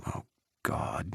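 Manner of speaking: unvoiced whisper under the breath; whispered dread. A narrator's reading. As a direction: mutter it in horror. Ethan's silent dread.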